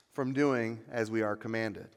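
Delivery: usual speaking voice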